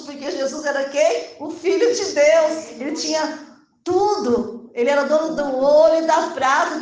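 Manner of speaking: loud, high, ringing exhortation; full-force preaching. Real, not acted. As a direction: proclaim it to the congregation with force. (pregação inflamada, voz alta e projetada)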